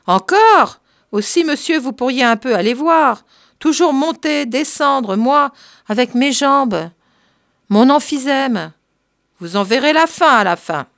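put-on high, loud complaining old servant; grumbling servant. Servant Marthe scolding the curé about the stairs, high and whiny. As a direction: whine about her legs and her breath.

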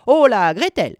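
high, swooping impatient call. A narrator's reading. Witch out of patience summoning Gretel, high impatient call. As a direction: holler for the girl, a touch theatrical. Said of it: "cria-t-elle".

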